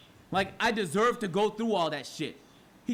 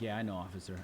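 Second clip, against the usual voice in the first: meek agreement; 11 semitones lower, 7 dB quieter, faster than usual. Retold or imitated. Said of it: "I'm going like".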